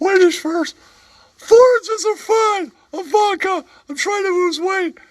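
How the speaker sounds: high, squeaky put-on character voice, with giggles; squeaky comic voice. Comic sidekick telling a bar story in a squeaky giggling voice. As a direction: play a giggling oddball sidekick.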